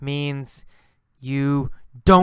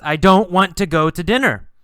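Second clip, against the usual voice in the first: fast, louder, higher refusal; brisk refusal; 5 semitones higher, 6 dB louder, faster than usual. Acted. Girl briskly refusing Carlos's dinner offer. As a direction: turn him down briskly. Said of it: "And she says".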